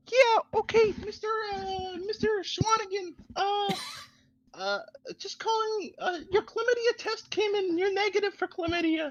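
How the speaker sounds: very high, soft mocking nurse; squeaky put-on nurse voice. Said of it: "What is this nurse voice".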